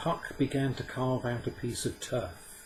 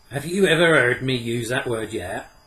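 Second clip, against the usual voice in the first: quick reproach; 4 semitones higher, 12 dB louder than usual. A narrator's reading. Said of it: "said Puck quickly".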